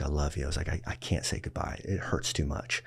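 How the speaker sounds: quiet, aching I love you; soft goodbye. Retold or imitated. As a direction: hold back the tears and keep it low. Tender farewell to Nanny, retold.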